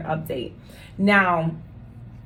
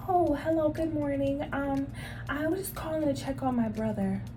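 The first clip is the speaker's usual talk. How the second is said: much softer, higher, timid relative; meek quiet caller; 5 semitones higher, 9 dB quieter than usual. Retold or imitated. Chastened relative answering the stern nurse, soft and meek. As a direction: shrink back meekly on the phone. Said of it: "they get real quiet".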